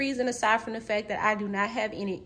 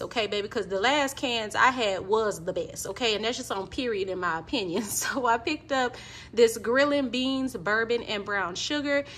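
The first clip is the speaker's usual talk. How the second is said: ordinary talk, nothing marked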